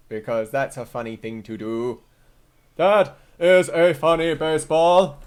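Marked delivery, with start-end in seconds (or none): 2.8-5.2 slow, loud, drawn-out proclamation; hammed-up announcing. Streamer clowning while setting up the stream, hammed-up announcing. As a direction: declare it slowly and loudly for a joke.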